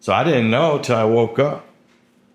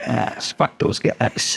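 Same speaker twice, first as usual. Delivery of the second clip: breathy whisper; 3 dB quieter, slower than usual. Retold or imitated. God voiced dismissing.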